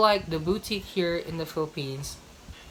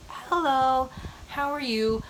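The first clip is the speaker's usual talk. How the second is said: imitating a sales assistant; 8 semitones higher, slower than usual. Imagined shop assistant greeting her.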